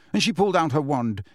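usual speaking voice

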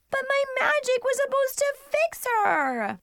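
put-on very high, tearful-sounding kid protest; whining little sister. Little sister over her broken doll, whining. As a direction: wail it high, sagging on the last word.

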